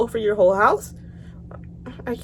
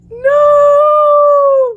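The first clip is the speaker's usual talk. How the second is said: very high, loud drawn-out no; shrill shout; 11 dB louder than usual. Real, not acted. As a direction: scream the no in protest.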